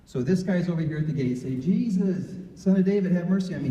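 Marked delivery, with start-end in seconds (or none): 1.6-3.7 higher, louder retold cry for mercy; yelling louder. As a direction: call out louder to be heard over the crowd. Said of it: "He starts yelling louder".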